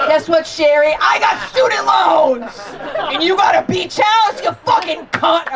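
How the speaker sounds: high, loud shouting outburst; yelling at Sherry over the inheritance, furious shout; furious rant. Real, not acted. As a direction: yell it at her, all-out fury. Ranting at her father's ex.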